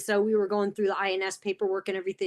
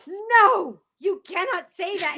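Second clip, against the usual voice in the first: high, loud imitation of a shocked friend; high-pitched, hammed-up warning; mock horrified objection; 9 semitones higher, 4 dB louder, slower than usual. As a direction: act out alarmed scolding, much higher. Acts out Alejandro's shocked objection.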